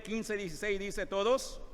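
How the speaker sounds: ordinary delivery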